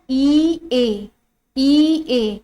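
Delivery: ई ए sounded as two separate drawn-out vowels. Shown as a demonstration. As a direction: say ई and ए apart, each held long. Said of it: "ई ए तो नहीं पढ़ते हो ना".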